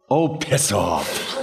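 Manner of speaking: very slow, drawn-out brush-off; disgusted dismissal. Retold or imitated. Outdone colleague turning to the impressionist, mock disgust. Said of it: "Norm just turns to me and says".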